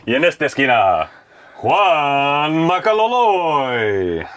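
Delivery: loud, drawn-out ring announcer call; lucha libre announcer. Pretend. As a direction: stretch the name out like a ring intro. Introducing fighter in the corner.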